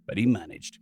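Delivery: usual speaking voice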